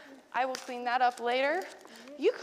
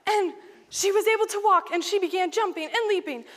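high, loud, jubilant narration; excited storytelling; 7 semitones higher, 9 dB louder than usual. A narrator's reading. Teacher telling children of the healed beggar, loudly jubilant. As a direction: build the miracle into a celebration. Joyful climax of acted Bible story.

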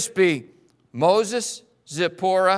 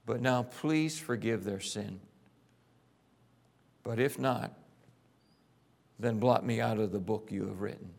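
pleading prayer; 8 semitones lower, 6 dB quieter than usual. Acted. Moses pleads for the people.

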